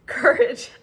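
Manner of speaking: surprised laughing squeal; delighted disbelief. Real, not acted. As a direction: say it again as if it can't be true. Repeats card name, laughing.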